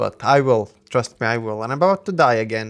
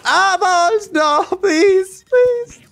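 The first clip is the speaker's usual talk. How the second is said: very high, loud pleading shriek; panicked screaming; 6 dB louder, much slower than usual. Real, not acted. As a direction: scream and beg for mercy. Panicking at rolling balls.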